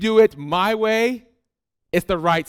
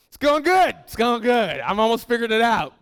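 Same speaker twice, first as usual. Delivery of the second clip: defensive; 4 semitones higher than usual. Retold or imitated. Defensive reply to spouse.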